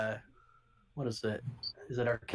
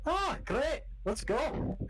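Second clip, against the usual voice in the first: a lightly voiced Scottish-Irish accent, pitched up; gruff Scottish guard calling out to strangers; rough Scottish accent; 13 semitones higher, much slower than usual. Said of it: "Jake is Irish".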